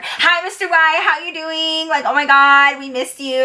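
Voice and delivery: high-pitched, fawning imitation; mock gushing. Rival waitresses competing for his attention, mock gushing.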